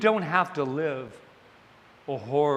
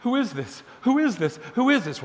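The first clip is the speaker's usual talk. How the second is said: rising panic; 7 semitones higher than usual. Retold or imitated. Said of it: "And she's".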